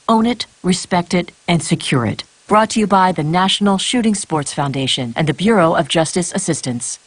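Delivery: normal talking voice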